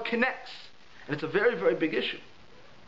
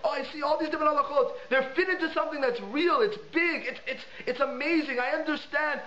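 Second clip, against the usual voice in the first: excited realization; 8 semitones higher than usual. Pretend. Imagined revelation at Har Sinai.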